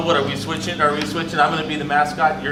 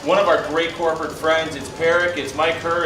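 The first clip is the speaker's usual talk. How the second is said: upbeat broadcast host intro; announcer voice; 3 semitones higher, 4 dB louder than usual. Acted. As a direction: open the show like a sports announcer. Tournament broadcast opening.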